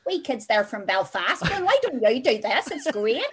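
imitated Belfast kids' accent; mock Belfast kid presenter. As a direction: put on a Belfast children's TV accent. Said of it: "these really weird, their accents".

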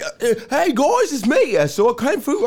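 high-pitched mock Irish visitor; put-on Irish accent. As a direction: ham up a bad Irish accent. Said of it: "someone here live from Ireland".

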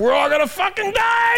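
very high, hammed-up scream; mock panic shriek. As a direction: shriek as if the world is ending. Mock panic over fiscal cliff.

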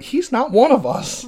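high, wounded mock outrage; hammed-up indignation; mock betrayal. Mock outrage at actor being British.

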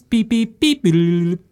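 high phone beeps; imitated phone ring. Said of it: "Jack Bauer's phone rings".

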